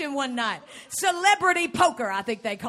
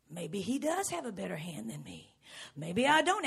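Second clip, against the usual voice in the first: fearful inner thought; 8 semitones lower, 9 dB quieter than usual. Retold or imitated. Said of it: "he's thinking".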